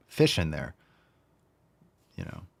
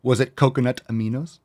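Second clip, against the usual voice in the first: ordinary talk, nothing marked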